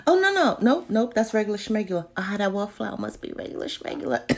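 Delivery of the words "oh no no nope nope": surprised no. Real, not acted. Reacting to a sold-out item.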